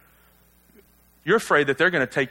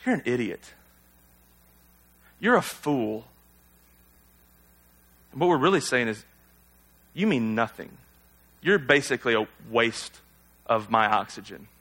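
low, quiet judgmental thought; understated disdain, low and measured; inner contempt; 6 semitones lower, 4 dB quieter than usual. Acted. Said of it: "we look at somebody else and go".

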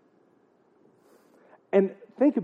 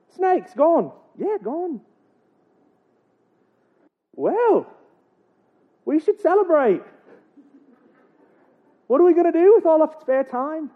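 high-pitched, hammed-up Irish lilt; two marvelling Irishmen, voiced high; mock Irish accent; 9 semitones higher than usual. Irish buddies plan to celebrate the snakes being gone, mock Irish accent. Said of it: "I'm gonna get that accent".

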